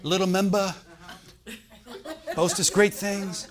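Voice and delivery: put-on caricatured Korean-accented English, phrase by phrase; imitated Korean accent. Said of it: "South Korean man".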